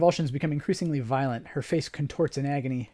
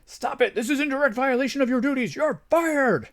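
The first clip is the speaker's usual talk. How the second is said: loud, high furious shout; a doctor firing a carer on the spot; enraged yelling doctor; fuming doctor; 10 semitones higher, 6 dB louder than usual. Acted. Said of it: "the fuming doctor".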